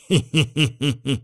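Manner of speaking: light, tittering laugh; short run of sly giggles; he-he-he snicker; chuckle. A narrator's reading. Narrator chuckles at Sanya helping.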